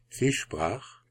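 plain reading voice

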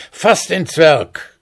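high, drawn-out order to a horse; called command. A narrator's reading. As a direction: call out the command, high and slow. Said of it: "und rief". (gedehnt gerufener Befehl)

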